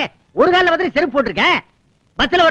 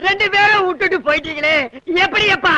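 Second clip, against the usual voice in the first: acted, raised-pitch lament; tearful wailing. Bereaved son crying out to messenger over both parents, wailing. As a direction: wail for both lost parents. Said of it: "அழுகாதீங்க".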